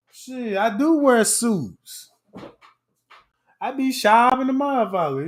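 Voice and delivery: ordinary delivery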